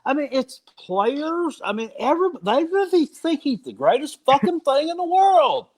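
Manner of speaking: high-pitched, incredulous ranting; exasperated rant. Real, not acted. Host ranting to his co-hosts about the doubters, exasperated. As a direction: rant in disbelief, voice pushed way up high.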